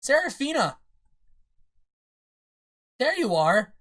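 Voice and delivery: high, loud cry of relief; re-enacted joyful shout; recreated excited call. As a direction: call out as if just spotting her. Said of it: "I'm gonna try and replicate my audio".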